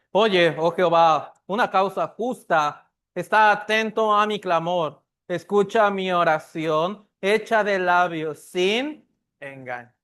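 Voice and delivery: loud group reading of a psalm; reading aloud together. Real, not acted. Pastor leading the church in reading, loud. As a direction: read the psalm out together, loudly.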